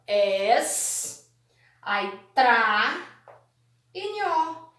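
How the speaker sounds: ES, TRA, NHO held apart; syllable by syllable. Shown as a demonstration. Teacher breaking estranho up for pupils, separate syllables. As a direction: count out each syllable of estranho with long gaps. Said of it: "como foi separada".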